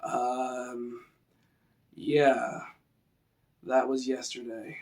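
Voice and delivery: slow, vague, quiet; slow and dim-witted. Shown as a demonstration. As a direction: let it trail like a slow thinker. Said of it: "So let's use that".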